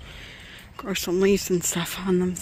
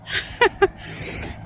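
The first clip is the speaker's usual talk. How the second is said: breathy laugh; 12 semitones higher, 4 dB quieter than usual. Real, not acted. Laughs at own joke about husband.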